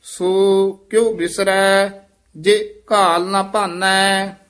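measured, level intoned Gurbani chant; scripture recitation. Acted. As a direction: intone each phrase evenly, drawing out the endings.

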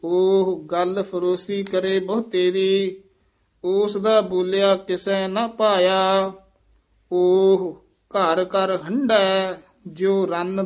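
measured chant-like reading with drawn-out line endings; scripture recitation. Shown as a demonstration. Teacher reciting Gurbani for students, measured chant. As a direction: intone each line on a level note.

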